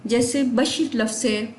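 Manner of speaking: usual speaking voice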